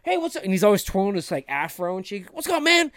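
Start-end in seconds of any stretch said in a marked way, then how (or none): 0.0-0.4 casual greeting. Retold or imitated. Old friend greets the driver with a high breezy hello. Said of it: "He's like". 2.3-2.9 high, loud laid-back hello; breezy greeting. Retold or imitated. Old friend greets the driver with a high breezy hello. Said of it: "repeats the friend's greeting".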